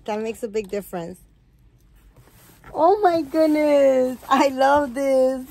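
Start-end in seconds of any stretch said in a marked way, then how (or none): 2.7-5.5 gushing delight. Real, not acted. Unboxing baby doll gift.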